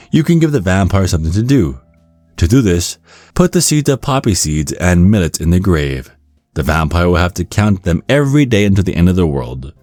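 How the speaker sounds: plain reading voice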